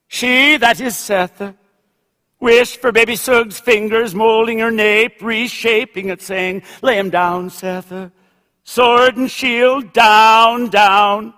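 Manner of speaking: emphatic, incantatory passage reading; literary reading. A narrator's reading. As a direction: read it out strongly, like a litany. Reads novel passage aloud.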